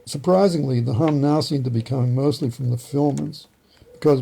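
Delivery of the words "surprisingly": ordinary talk, nothing marked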